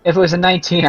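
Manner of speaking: usual speaking voice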